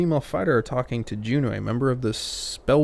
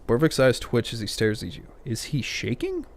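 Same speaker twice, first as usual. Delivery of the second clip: ordinary delivery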